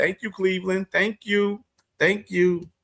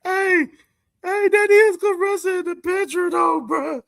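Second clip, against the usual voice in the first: laughing high. Real, not acted. Laughing hard at a picture.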